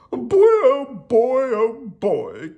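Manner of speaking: high, loud, drawn-out lament; hammed-up royal complaint; complaining king. A narrator's reading. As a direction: moan it big like a cartoon king. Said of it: "complained the king".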